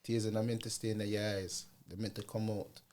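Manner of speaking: imitated Jamaican accent, kept soft. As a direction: softly quote granddad in Jamaican patois tones. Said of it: "he said it in a Jamaican accent".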